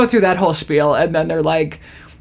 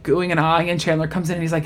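ordinary talk

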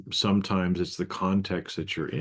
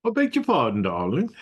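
hammed-up posh pardon; 7 semitones higher, 4 dB louder than usual. Topping the posh retort.

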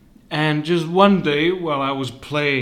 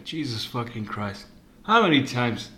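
usual speaking voice

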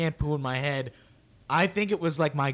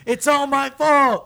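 put-on high, loud, slow kid wail; hammed-up sobbing confession; mock wailing; 12 semitones higher, 10 dB louder, slower than usual. Little boy wailing to his dad, mock sobbing. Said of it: "I'm crying".

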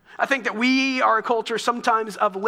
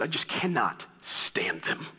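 low, slow mock grumble of a fed-up friend; a hint of a weary, exasperated friend; grumbling friend; 7 semitones lower, 4 dB quieter, slower than usual. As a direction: lightly play the annoyed friend, dropping low. Friend venting, voiced by pastor.

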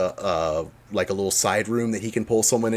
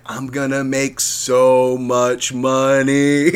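greedy gloating; 4 dB louder, much slower than usual. Retold or imitated. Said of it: "Bagman's like".

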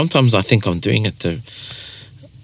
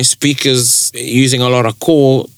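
normal talking voice

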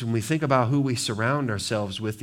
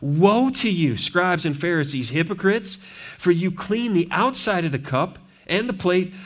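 raised, forceful preaching of woes; 5 semitones higher, 3 dB louder than usual. Acted. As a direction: thunder the woe over the crowd.

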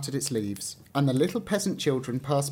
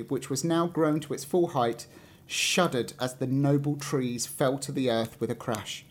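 ordinary delivery

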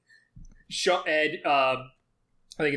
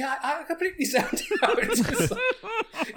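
laughing. Real, not acted. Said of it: "it's just dumb".

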